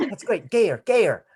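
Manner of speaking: high-pitched retold encouragement; lightly acted eager direction; director's urging.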